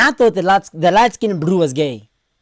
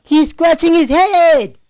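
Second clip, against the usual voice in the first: high, loud, drawn-out exclamation; incredulous yell; 9 semitones higher, 5 dB louder, slower than usual. Real, not acted. Reactor amazed at the hesitating boyfriend, incredulous yell. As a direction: cry it out in disbelief, stretching the words.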